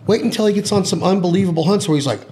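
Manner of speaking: ordinary talk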